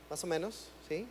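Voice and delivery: usual speaking voice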